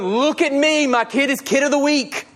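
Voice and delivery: mock a boasting parent, lightly; a hint of a bragging parent posting online; mocking show-off parent. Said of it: "we are not getting on there and going".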